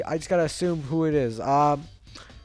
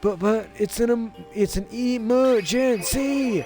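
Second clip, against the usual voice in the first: high, slow dazed stoner voice; 8 semitones higher, slower than usual. Acted. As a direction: drawl it out like a stoner. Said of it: "my stoner voice for you".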